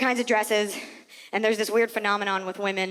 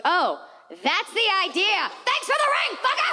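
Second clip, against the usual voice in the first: squeaky high sarcastic shriek, laughing; shrill mock fury at the fiance, climbing higher; mock high outraged reply; 8 semitones higher than usual. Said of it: "And I was like".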